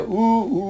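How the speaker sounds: imitated ship horn. Ship's horn as boat leaves.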